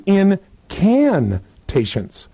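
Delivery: "incantations" broken up, CAN set apart and drawn out; slow right down and punch "can" in "incantations"; deliberate syllable-by-syllable "in-CAN-tations"; emphasis on CAN. Shown as a demonstration. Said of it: "different emphasis".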